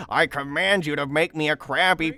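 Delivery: robotic little villain voice; Plankton voice. Retold or imitated. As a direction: voice Plankton with beeps. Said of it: "when Plankton was like".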